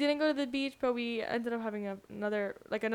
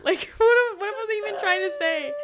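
laughing; 8 semitones higher, 6 dB louder than usual. Real, not acted. Laughing at own fumbled words.

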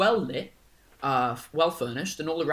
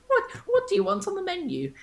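put-on teen boy voice; faster than usual. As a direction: squeak it like a boy mid voice break. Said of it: "voice hasn't quite settled yet".

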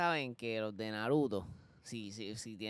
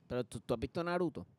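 fast nerdy anime fan voice; otaku voice; faster than usual. Pretend. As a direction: ask it like an eager otaku. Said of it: "Con, con voz de otaku era".

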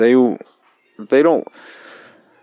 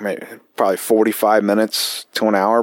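normal talking voice

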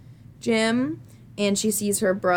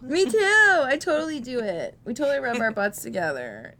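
excited; 6 semitones higher than usual. Pretend. Playing along in mock voice.

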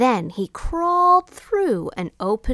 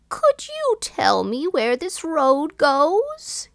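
high, slow beggar's question; old beggar voice; 7 semitones higher, slower than usual. A narrator's reading. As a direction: ask it slowly as a frail old beggar. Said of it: "he asked".

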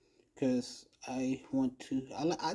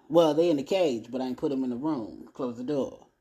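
ordinary talk

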